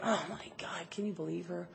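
very soft, low catty aside; imitated gossipy murmur; judgmental muttering. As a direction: mutter it under your breath in disapproval. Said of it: "it makes those noises like".

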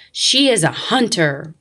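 loud but controlled storybook roar. A narrator's reading. Lion joining the argument about God, mild roar. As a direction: push it out loudly, a contained roar. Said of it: "roared the lion".

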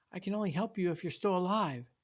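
high, emphatic plea; earnest, urgent motherly appeal; pleading mother. A narrator's reading. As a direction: implore your child, pitch swooping up. Mom pleads with her son.

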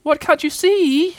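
very high, slow squealed shout; exasperated high-pitched shout. A narrator's reading. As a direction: yell it in a tiny creature's high voice. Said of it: "shouted Piglet".

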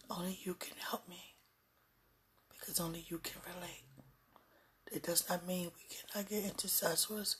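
soft, intimate, pleading whisper. Real, not acted. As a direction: whisper a heartfelt plea. Intimate prayerful plea.

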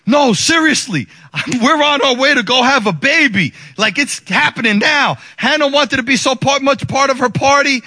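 loud, high-pitched insistence; insistent announcement. Retold or imitated. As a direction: convince a disbelieving crowd. Insisting to disbelieving party guests.

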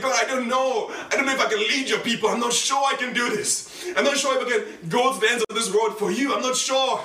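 high, loud, anguished confession of doubt; retold crying prayer. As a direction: re-enact a tearful plea to God. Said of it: "And I was crying".

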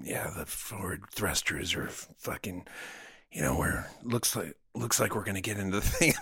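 husky, laid-back line read; low-key rehearsal read. Acted. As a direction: toss it off coolly, dragging it a little. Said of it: "before, when I was doing it in".

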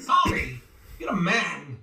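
high, quirky Walken voice; Christopher Walken impression. In the booth, doing Walken for the engineer. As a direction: launch into a Walken bit. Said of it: "I was just doing the whole thing".

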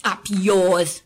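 loud, contemptuous retort; snarl. A narrator's reading. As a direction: snap it at the ceiling. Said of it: "Marla snarled".

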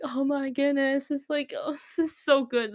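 slow, high-pitched swooning delight; gushing. Retold or imitated. Fan gushing over the singer's account posting, gushing. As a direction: draw it out high, savouring the thrill.

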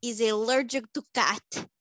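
deadpan put-on Eastern European accent; Svetlana accent. Svetlana greets the podcast hosts in a thick put-on accent. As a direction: play Svetlana, blunt foreign guest.

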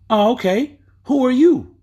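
put-on teen brushing off an adult; cheeky teenage boy. Boy voiced in the retold story.

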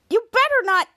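high-pitched bossy threat; warning. Retold or imitated. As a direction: imitate an indignant kid warning the doorman. Piper warns Jasper over the card.

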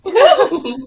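high, loud helpless laughter; laughing hard. Real, not acted. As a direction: crack up loudly. Bursts out laughing.

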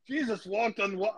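put-on weak try at a Yiddish accent.

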